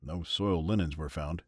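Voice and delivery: usual speaking voice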